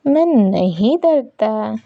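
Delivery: drawn out, stubborn insistence; slow insistent denial. Acted. Han insists to Wang he isn't scared, slow and stubborn. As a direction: dig in and say it slowly.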